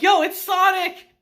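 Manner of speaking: high, loud fanboy cry; like spotting your hero; excited. Retold or imitated. Uncle thinks it's Sonic.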